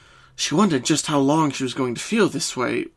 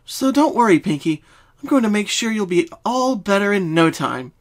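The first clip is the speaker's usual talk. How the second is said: raised, lightly voiced confident unicorn; confident Twilight; 7 semitones higher, 3 dB louder than usual. A narrator's reading. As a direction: reassure her with calm certainty. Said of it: "She gave a friend a confident smile".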